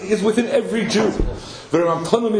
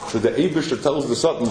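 ordinary talk

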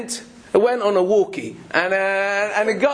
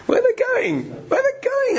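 very high-pitched jeering imitation; mock sniggering. Crowd mocking Moshe on his search for the rock, mock sniggering. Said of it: "start laughing and start sniggering".

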